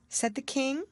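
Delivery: plain reading voice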